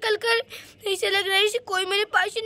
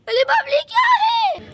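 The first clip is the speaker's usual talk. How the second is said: high shrieking cry of fear; panicked high shriek; terrified scream; 13 semitones higher, 3 dB louder than usual. Acted. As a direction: scream at the sudden ghost. Girl sees the ghost again.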